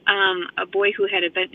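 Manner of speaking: normal talking voice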